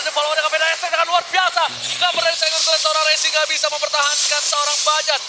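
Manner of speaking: hyped esports caster shouting the play; fighting game announcer. Real, not acted. Hype caster call of a triple kill.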